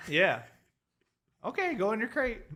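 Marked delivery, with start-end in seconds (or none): 1.4-2.4 higher sing-song pet talk; talking to a dog. Shown as a demonstration. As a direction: tell the dog to go to its crate, lightly. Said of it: "Pretend like I'm your dog".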